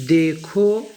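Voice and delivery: very slow, syllables stretched for learners; deliberate classroom reading; slow model reading. Shown as a demonstration. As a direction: read it out word by word, drawn out. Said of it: "यहाँ पर देखो".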